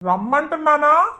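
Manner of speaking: staged anger. Acted. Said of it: "నువ్వు భలే act చేసావు".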